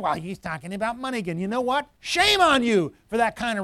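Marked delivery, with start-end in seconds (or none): 0.0-1.4 quick, softer mock grumble; oversensitive complaint. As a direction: mimic a touchy churchgoer complaining under their voice. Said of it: "don't be oversensitive".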